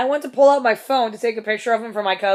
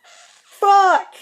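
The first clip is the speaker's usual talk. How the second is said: high loud laughing fuck; caught-out laughing shout; laughing curse; 10 semitones higher, 7 dB louder than usual. Real, not acted. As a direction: blurt it out through a laugh. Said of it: "I'm like".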